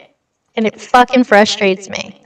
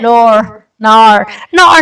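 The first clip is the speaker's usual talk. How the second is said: repeated tries at Australian "no", getting louder; failed Australian accent; 5 dB louder, much slower than usual. Retold or imitated. Streamer failing to mimic Australians, louder attempted Australian accent. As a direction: exaggerate "Nor" and "Nar" as a botched Australian accent. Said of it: "No, I can't".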